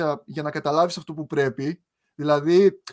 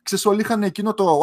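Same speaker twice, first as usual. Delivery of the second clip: ordinary talk, nothing marked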